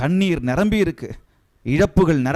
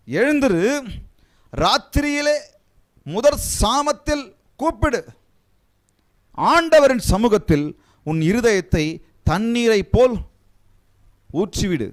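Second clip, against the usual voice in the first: scripture proclamation; 7 semitones higher than usual. Acted. Rereads verse, higher, intoned.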